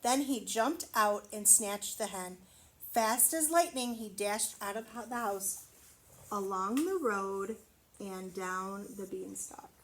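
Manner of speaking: plain reading voice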